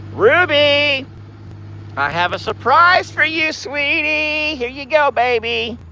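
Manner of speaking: cooing to cows. Acted. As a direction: coo brightly to greet each cow by name.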